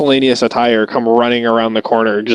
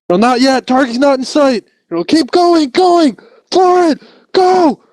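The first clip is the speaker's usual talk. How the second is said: frantic gunner yell. Retold or imitated. Gunner in turret yelling at driver Florent, frantic shout. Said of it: "a voice up from a turret above, like".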